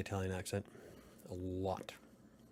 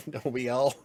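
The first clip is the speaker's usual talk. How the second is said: louder, laughing quip; 3 semitones higher, 6 dB louder than usual. Real, not acted. Streamer laughing back at a chat comment. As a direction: laugh it out while answering.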